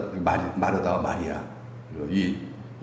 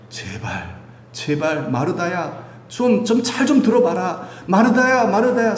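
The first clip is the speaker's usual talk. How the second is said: earnest loving plea; 7 semitones higher, slower than usual. Acted. Jesus pleading with Martha to listen. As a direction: beg her to listen, lovingly. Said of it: "사랑하는 마음이에요".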